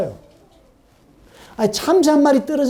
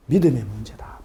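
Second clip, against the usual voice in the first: whisper; 9 semitones lower, 5 dB quieter than usual. Real, not acted. Preacher whispering the point to the congregation. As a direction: drop to a hushed whisper.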